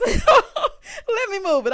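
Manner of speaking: high, loud, drawn out through laughter; laughing eagerness. Acted. Host jokes she'd gladly move the car, laughing. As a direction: burst out laughing as you volunteer. Joking as the woman taking keys.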